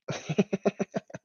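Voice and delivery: high breathy giggle; amused run of little chuckles. Real, not acted. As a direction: laugh at the absurd memory. Amused at his own story.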